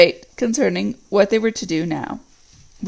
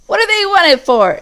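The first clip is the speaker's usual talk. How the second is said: orc voices; 9 semitones higher, 6 dB louder than usual. Acted. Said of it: "asked several voices".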